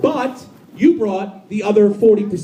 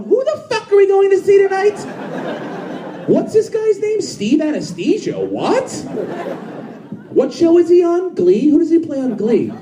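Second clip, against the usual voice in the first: high-pitched, baffled grilling; mock disbelief pitched way up; incredulous questioning; 13 semitones higher than usual. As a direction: act out a baffled companion demanding answers. Voices baffled audience member grilling their companion.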